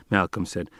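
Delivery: ordinary delivery, nothing marked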